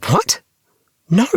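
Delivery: sudden high shocked yelp; exclaiming. Acted. Tim denies stealing knickers, shocked high exclamation. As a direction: blurt it out, high and startled. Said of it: "I exclaimed".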